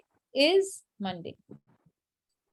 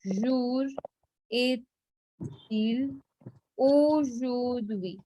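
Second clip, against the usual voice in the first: French question spaced word by word; deliberate, drawn-out teaching pronunciation; slow demonstration; much slower than usual. Shown as a demonstration. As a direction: model each word separately, with pauses. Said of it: "So if I say".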